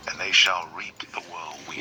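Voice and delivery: hushed scripture reading; whispered scripture. Real, not acted. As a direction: read the verse in a whisper.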